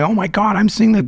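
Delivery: high, retold freak-out. Tripping friends share a hallucination, freaking out. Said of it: "like, freaking out, going".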